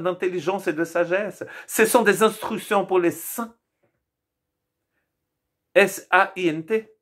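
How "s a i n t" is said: saint spelled letter by letter, S A I N T; épelé lettre par lettre. Shown as a demonstration. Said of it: "S en plus, parce que c'est au pluriel".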